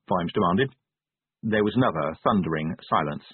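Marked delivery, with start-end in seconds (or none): none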